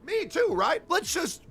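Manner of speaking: mock them with a high, taunting voice; sarcastic mockery. Sarcastic mocking list of names.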